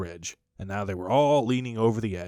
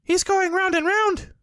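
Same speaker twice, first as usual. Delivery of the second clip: very high, loud little kangaroo cry; high squeaky baby kangaroo; excited Roo cry; 6 dB louder than usual. A narrator's reading. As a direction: squeal it out like a tiny excited joey. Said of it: "said Roo, much impressed".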